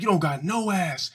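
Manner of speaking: put-on high teasing kid voice; taunting kid. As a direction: boys tease a girl with a high mock insult. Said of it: "We'd be like".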